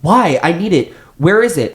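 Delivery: high, frantic questioning; hysterical. A narrator's reading. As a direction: fire off panicked questions up high. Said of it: "She's hysterical".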